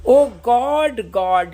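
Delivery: loud, slow lament of oh God; slow recitation. Shown as a demonstration. Teacher voicing Hamlet's cry to God, slow and loud.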